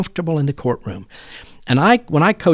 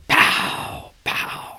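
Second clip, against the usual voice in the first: breathy imitated whip cracks; 3 dB quieter than usual. Riding down the road cracking whips, imitated whip snaps. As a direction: mimic the snap of a cow whip.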